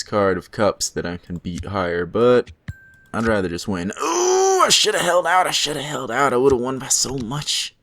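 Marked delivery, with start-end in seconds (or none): 4.0-7.8 high, loud groan of regret; dismayed regret. Real, not acted. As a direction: kick yourself over the missed win. Regret over a lost card.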